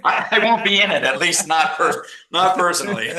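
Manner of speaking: high, loud and laughing through the words; real giggly protest. Real, not acted. Joking denial while laughing.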